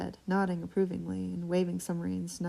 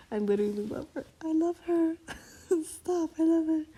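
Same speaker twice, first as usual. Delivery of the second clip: squealing, much higher and louder; gushing delight; 11 semitones higher, 6 dB louder, faster than usual. Real, not acted. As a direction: gush with giddy laughter. Gushing over a character.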